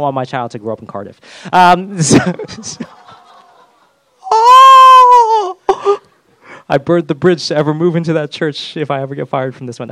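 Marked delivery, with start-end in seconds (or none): 4.3-5.6 loud, high startled cry of "oh"; sudden cry. Real, not acted. A pastor catching his own joke, startled cry. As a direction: blurt a shocked yelp. Sudden outcry mid-sermon.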